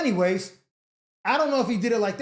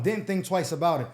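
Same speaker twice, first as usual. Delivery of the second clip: ordinary talk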